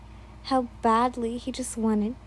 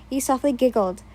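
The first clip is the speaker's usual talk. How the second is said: ordinary delivery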